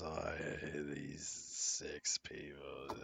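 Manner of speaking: low, slow, soft grumble; grumbling. Acted. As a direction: grumble it under your breath, drawn out. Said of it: "grumbling the whole time".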